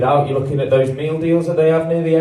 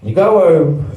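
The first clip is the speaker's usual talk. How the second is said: ordinary talk, nothing marked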